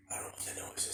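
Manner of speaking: hushed nervous whisper. Real, not acted. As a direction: whisper it, listening for a noise. Hears a noise outside.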